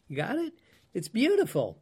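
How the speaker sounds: normal talking voice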